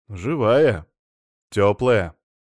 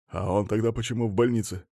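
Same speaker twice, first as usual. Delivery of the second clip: ordinary delivery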